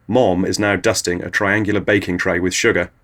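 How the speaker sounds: ordinary delivery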